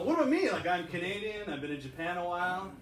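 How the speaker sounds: normal talking voice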